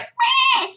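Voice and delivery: high strangled mock meow; cat meow imitation. Imitating her choking cat, high mock meow. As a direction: squeak out a cat's choked meh. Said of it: "he's like".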